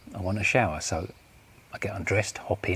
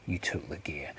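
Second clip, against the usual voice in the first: low, softened statement; hushed, knowing accusation; quiet accusation; 4 semitones lower, 7 dB quieter than usual. Acted. Accusation, first take before repeat.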